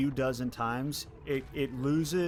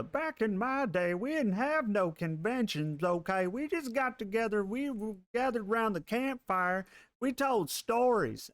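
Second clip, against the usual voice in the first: put-on old Southern man voice; 9 semitones higher than usual. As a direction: play a grumpy old Southern grandpa. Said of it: "I don't know why that's my voice".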